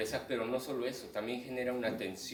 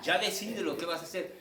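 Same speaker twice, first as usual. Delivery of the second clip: higher, amused impatient demand; urgencia exasperada; 6 semitones higher, 4 dB louder than usual. Acted. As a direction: play the impatient spectator urging a decision. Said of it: "se convierte en una urgencia de". (exigencia impaciente, aguda y divertida)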